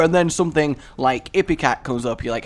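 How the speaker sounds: usual speaking voice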